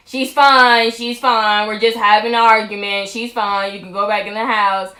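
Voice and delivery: loud, brusque dismissal; mock rude insistence; imitating rude boyfriend. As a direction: wave the neighbor off loudly and rudely. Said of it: "Josh being rude as fuck".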